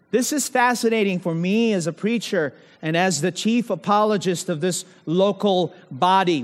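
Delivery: ordinary talk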